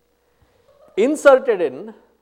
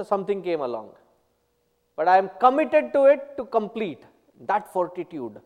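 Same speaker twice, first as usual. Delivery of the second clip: usual speaking voice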